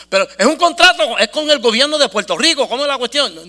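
high, pushy retold questions; eager insistence. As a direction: act out an excited partner pressing for details. El socio insiste entusiasmado. (socio entusiasmado que insiste)

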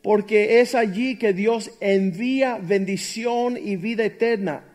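loud, slow, emphatic preaching; fervent pulpit delivery; full-force preaching. Acted. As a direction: preach it out to the congregation.

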